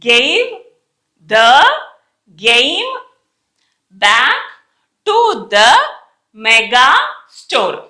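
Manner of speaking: practice sentence with a gap after nearly every word; isolated words, separated by long pauses; word-by-word reading. Shown as a demonstration. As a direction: read it out word by word for learners. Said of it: "try now sentence".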